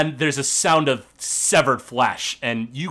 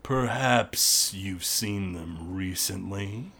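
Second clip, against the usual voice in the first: low, slow, menacing orc voice; gruff orc boss; 7 semitones lower, 8 dB quieter, slower than usual. Acted. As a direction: growl it slowly through a door. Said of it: "the gruff voice of Lockgrim".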